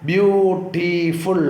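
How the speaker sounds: Beautiful drawn out slowly as ബ്യൂ, T, ഫുൾ; three slow beats on beautiful; split into syllables. Shown as a demonstration. Teacher teaching syllables to pupils, slowly split. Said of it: "ബ്യൂ എന്നുള്ളത് ആദ്യത്തെ syllable".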